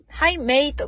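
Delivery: "hi, mate" with a clear "ay" in mate; clearly separated "hi, mate", full vowel in mate; unaccented "hi, mate". Shown as a demonstration. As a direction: say "mate" to rhyme with late. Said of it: "なまって".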